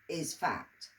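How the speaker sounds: usual speaking voice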